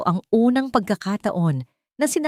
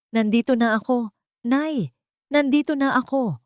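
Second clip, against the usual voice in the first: usual speaking voice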